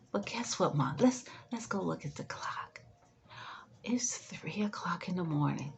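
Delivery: soft whisper, leaning in close; gently whispering to a mother who is up at three a.m; hushed whisper. Shown as a demonstration. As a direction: whisper it so the house stays asleep. Soothing mom awake at 3am.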